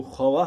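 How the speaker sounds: ख्वाह said as "kha-waah", W voiced; sounded silent vav. Shown as a demonstration. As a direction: give ख्वाह two syllables, kha + waah. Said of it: "तो ख्वाह होना चाहिए था". (ख्वाह میں واؤ پڑھ کر "خَ-واہ" بولیں)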